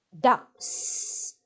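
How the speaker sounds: whispered ducks, air pushed out on the s; breathy s ending. Shown as a demonstration. Teacher showing the airy s sound in ducks. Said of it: "Âm gió ra nhé".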